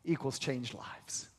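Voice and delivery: slow, whispered punchline; hushed whisper. Real, not acted. Preacher finishing his equation to the congregation, whispering. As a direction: let it land in a whisper.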